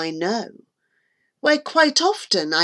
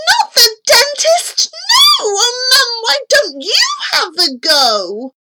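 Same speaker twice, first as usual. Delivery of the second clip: high-pitched, panicked wail; loud shriek easing into a plea; pleading shout; 13 semitones higher, 3 dB louder than usual. A narrator's reading. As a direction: cry out in protest and beg Mum. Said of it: "I shouted".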